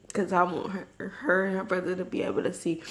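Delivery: usual speaking voice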